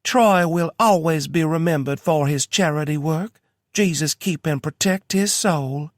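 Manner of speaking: Kentucky preacher. A narrator's reading. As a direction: preach it with a thick Kentucky accent. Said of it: "in imitation of a preacher".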